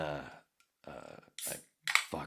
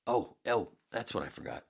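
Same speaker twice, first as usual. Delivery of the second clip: ordinary talk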